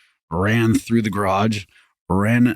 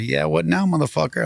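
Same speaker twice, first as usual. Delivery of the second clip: higher, mocking taunt over a beaten man; taunting yell; 7 semitones higher than usual. Standing over the knocked-down thief, higher taunting yell. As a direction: gloat down at him with a raised voice.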